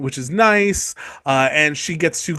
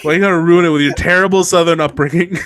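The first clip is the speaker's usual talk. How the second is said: ordinary talk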